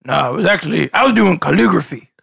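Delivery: lightly voiced dim fisherman; a hint of a cheerful simple NPC; Toko voice.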